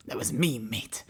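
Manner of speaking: hushed Cockney mimicry; muted mock Cockney. Player repeating the robot's line to self, hushed mock Cockney. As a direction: echo the Cockney under your breath.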